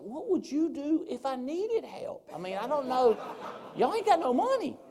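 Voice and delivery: high, squealing incredulity; hammed-up disbelief; incredulous comeback. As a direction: throw the question back, voice climbing very high. Acting out comeback to doubters.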